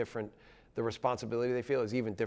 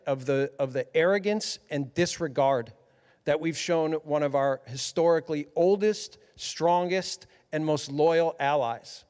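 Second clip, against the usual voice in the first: loud, raised-pitch public plea; emphatic rebuke; 5 semitones higher, 6 dB louder than usual. Real, not acted. Coach sends a message to the president, emphatic rebuke. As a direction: press each word home forcefully.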